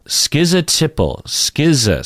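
"Schizotypal" as skiz-uh, no T. Shown as a demonstration. Teacher echoing a no-T version. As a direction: drop the T in "schizo". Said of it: "no T in there".

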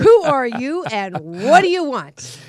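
very loud, drawn-out mock demand; questioning answering machine. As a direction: boom the greeting slowly at the guest. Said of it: "She has a questioning machine".

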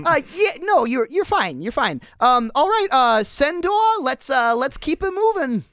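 a startled little man rushing everyone; squeaky startled cameraman. Acted. Cameraman voiced again.

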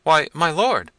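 high, loud frightened cry; trembling servant. A narrator's reading. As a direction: plead up high to your angry master.

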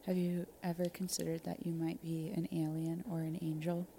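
ordinary talk

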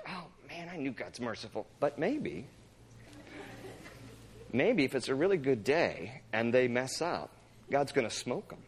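low, soft grumbling to himself; Jonah scheming quietly. Retold or imitated. Jonah watching the city from afar, quietly hopeful. Acts out sulking Jonah secretly hoping.

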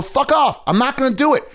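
high-pitched, heated retort on others' behalf; indignant quoted pushback; defiant refusal. Retold or imitated. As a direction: imitate someone standing up and refusing. Acts out defiant comics refusing.

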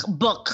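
"book" with a phlegmy, throaty final k; phlegmy pronunciation. Retold or imitated. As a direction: hack the end of "book" like clearing phlegm. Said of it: "It's phlegmy. It's like".

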